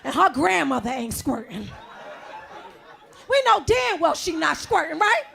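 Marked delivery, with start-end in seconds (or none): 3.3-5.2 shouting laugh. Real, not acted. Comic ranting to the crowd, laughing shout. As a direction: burst out laughing in outrage. Mock outrage climax.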